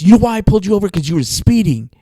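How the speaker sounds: loud, scolding policeman; yelling cop. Retold or imitated. Cop telling driver why he pulled them over, yelling. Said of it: "the cop yells at us".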